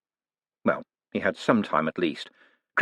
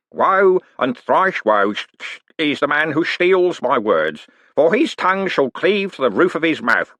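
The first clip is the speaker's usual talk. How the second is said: slow, loud, higher street-preacher cry; mock preaching; 8 semitones higher, 4 dB louder than usual. Con man begging in the bank, loud mock street preacher. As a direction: boom it to the whole hall.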